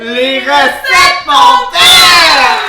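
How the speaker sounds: high, very slow shouted title; announcer shout. Acted. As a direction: belt out the show name.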